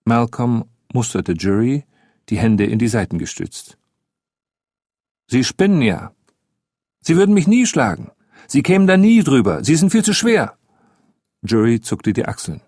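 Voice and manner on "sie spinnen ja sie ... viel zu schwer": put-on high, heated boyish protest; indignant boy. Boy telling the adult he could never win, indignant. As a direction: protest scornfully in a raised child's pitch.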